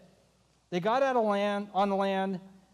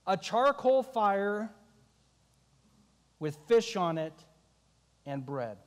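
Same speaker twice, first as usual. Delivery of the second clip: slow reveal; slower than usual. Real, not acted.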